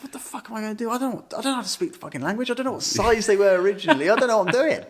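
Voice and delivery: high, fast mock panic, voice jumping up; panicked rant. Lost abroad without bolts, mock panic. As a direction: blurt it out in a rising fluster. Panicked inner monologue at DIY shop.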